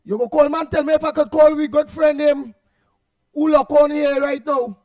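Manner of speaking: loud on-air shout-out; calling out on air. Real, not acted. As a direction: shout the greeting down the phone line. Caller shouting greetings on air.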